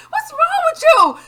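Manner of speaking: high, loud disbelieving shout; loud exasperated half-laughing yell; retold outraged yell. Retelling shouting at mother.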